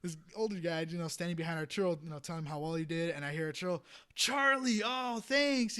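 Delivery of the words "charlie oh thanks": excited greeting. Retold or imitated. Arturo greets Charlie.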